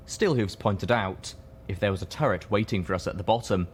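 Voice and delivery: ordinary delivery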